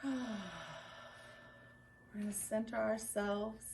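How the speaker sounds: gentle, unhurried meditation delivery; guided centering. Real, not acted. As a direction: lead a quiet breathing moment, with long pauses. Leads a centering breath.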